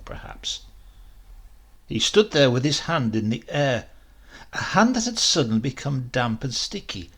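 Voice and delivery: usual speaking voice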